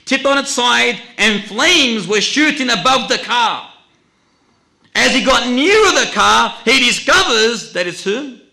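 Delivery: loud, high-pitched dramatic narration; urgent preaching. Real, not acted. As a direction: hurl out the story at full force.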